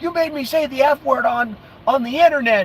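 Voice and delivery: high-pitched imitation of a woman complaining; high mock wail; mock upset woman. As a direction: imitate her high, scandalized protest. Imitates upset woman who ran away.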